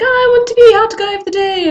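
high, bright eager request. Pretend. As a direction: beg to be picked, sweetly. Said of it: "be like".

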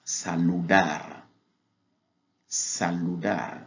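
"Saludar" said slowly, every syllable clear; teacher models "Saludar" slowly for students; slow Spanish word. Shown as a demonstration. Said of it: "comment saluer".

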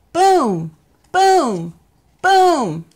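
loud, rising drum booms; booming drum. Acted. Narrator imitating Mouse's drum, loud booms. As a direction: boom out like a big drum.

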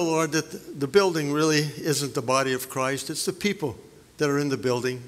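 low, hushed reverent prayer voice. Acted. Pastor opening the assembly in prayer, reverent and hushed.

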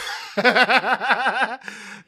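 a long, rising real laugh; real laughter. Real, not acted. Cracking up at what Brian does after drinking. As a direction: laugh freely at the joke.

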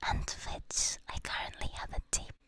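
breathy unvoiced whisper throughout. Real, not acted. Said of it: "I have to whisper".

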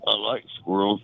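usual speaking voice

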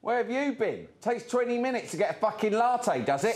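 high-pitched furious telling-off; angry boss. Acted. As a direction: tear into the runner. Berating his runner self.

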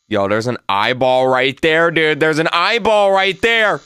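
alarm climbing to a high pitch; startled excitement. Real, not acted. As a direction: build from talk to a high, stretched cry. Spots eyeball in horror game.

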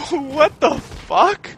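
very loud, high, drawn-out disbelief; slow, high cry of bewilderment; shocked. Real, not acted. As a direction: stretch it out, laughing in shock. Shocked by enemies.

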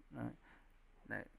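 ordinary talk, nothing marked

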